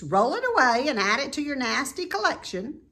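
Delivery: high, loud, fast scornful goose. A narrator's reading. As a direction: scoff at the rat. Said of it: "replied the goose".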